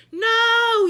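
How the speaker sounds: high loud desperate yell. A narrator's reading. Miss Sookie stopping the custodian, a loud desperate yell. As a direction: stop her with a cry. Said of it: "yelled Miss Sookie".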